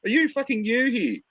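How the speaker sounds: hammed-up gasping outrage in a squeaky voice; outraged high mock voice. Mocking an offended internet commenter, squeaky outrage. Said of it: "leave a fucking smart ass comment".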